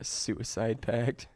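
slow, disbelieving repeat of "suicide pact"; drawn-out stunned echo of "suicide pact"; slow incredulous question. Real, not acted. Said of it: "What would their suicide pact be?".

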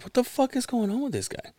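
baffled aside. Retold or imitated. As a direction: squeak it aside to a friend in disbelief. Baffled aside to his friend.

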